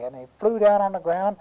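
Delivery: ordinary delivery, nothing marked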